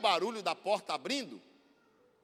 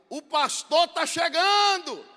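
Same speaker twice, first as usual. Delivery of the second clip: high, loud and drawn out, slow; neighbor shouting news; 12 semitones higher, 15 dB louder, much slower than usual. Retold or imitated. Neighbor announcing the pastor's arrival, slow loud high shout. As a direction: stretch out the shout so the whole street hears. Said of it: "aí a vizinha grita de lá".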